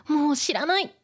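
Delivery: lightly acted big sister losing her cool; mock exasperated outburst. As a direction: throw up your hands in mild exasperation. Acts out the sister's angry outburst.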